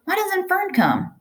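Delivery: a hint of a piglet on the verge of tears; lightly voiced tearful piglet. A narrator's reading. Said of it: "He began to cry".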